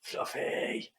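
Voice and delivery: breathy whispered Fluffy; whispered squeal. Real, not acted. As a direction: whisper it in adoration. Cuddling the bear's paw.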